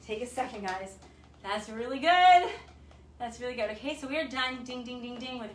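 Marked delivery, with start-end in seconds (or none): 1.4-2.5 loud, slow, stretched-out praise; big hype for the class after a tough set; excited praise. Real, not acted. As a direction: cheer the class on.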